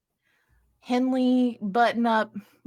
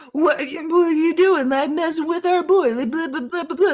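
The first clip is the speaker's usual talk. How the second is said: higher-pitched mock angry heckling; mock confrontational; 8 semitones higher than usual. Shitty men confront her for messing with their boy, mock confrontational. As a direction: trail into blah-blah mockery of angry men.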